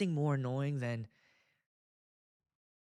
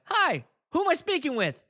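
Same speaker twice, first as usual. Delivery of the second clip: chirpy phone rep; 11 semitones higher, 5 dB louder than usual. Retold or imitated. Phone representative greeting.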